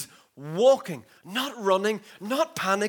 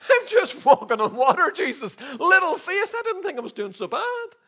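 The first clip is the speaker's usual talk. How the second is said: high-pitched mock indignation; wounded protest; 9 semitones higher, 3 dB louder than usual. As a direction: protest as a hurt, disbelieving Peter. Voices Peter protesting the rebuke.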